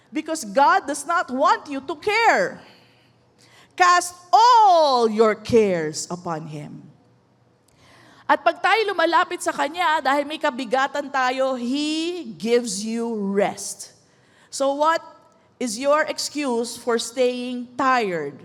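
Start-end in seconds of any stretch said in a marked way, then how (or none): 3.8-6.9 slow, with a big loud stretched ALL; emphatic exhortation. Real, not acted. Preacher urging listeners to release every care, emphatic stress on all. 11.6-13.9 very slow, drawn-out promise; soothing assurance. Real, not acted. Preacher assuring burdened listeners of rest, soothing.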